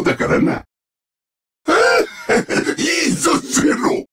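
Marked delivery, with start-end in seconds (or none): 1.7-2.7 high rising gleeful cackle; villainous cackle. Acted. Villain pleased with Zero, high gleeful cackle. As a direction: cackle with sudden wicked delight.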